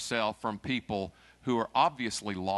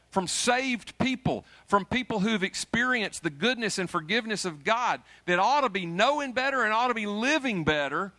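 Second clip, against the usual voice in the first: much higher and louder, rising fervour; preaching at full force; 10 semitones higher, 5 dB louder than usual. Acted. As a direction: drive it home to the congregation.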